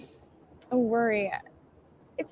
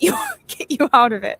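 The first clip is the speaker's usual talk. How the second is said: laughing; 5 semitones higher, 5 dB louder than usual. Real, not acted. Laughing while promising God's help.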